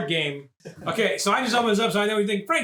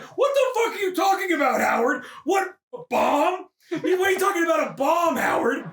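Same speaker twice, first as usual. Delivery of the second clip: mock angry wife; 9 semitones higher than usual. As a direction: demand answers, shrill with disbelief. Wife confronting husband about bomb.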